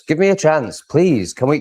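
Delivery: ordinary talk, nothing marked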